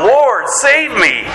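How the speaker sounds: high, drawn-out plea of a sinking man; acted desperate cry, very slow and high; crying out for help. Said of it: "and he cries out".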